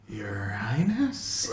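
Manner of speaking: whispered, slow and unsure; baffled whisper. Acted. Old master whispering his confusion to the princess. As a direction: breathe it out in disbelief.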